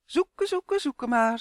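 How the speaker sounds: high, teasing witch voice, sing-song; a mischievous little hag taunting lost travellers; giggling stone witch. A narrator's reading. Stone witch teasing the lost travellers, high and giggly. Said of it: "Een grijzig vrouwtje dat giechelde".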